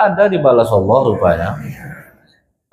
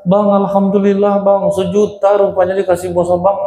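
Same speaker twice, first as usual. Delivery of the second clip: higher, happy wife's voice with a laugh; delighted wife announcing the money; excited wife; 5 semitones higher than usual. Retold or imitated. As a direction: tell him the good news, thrilled.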